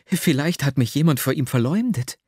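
usual speaking voice

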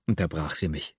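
ordinary delivery, nothing marked

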